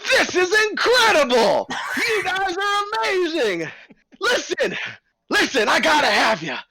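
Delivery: high, loud, eager showman host; a fast-talking circus boss buttering up the bears; the ringleader, high and loud, coaxing a new act. Acted. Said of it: "He's like".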